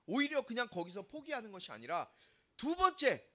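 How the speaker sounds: usual speaking voice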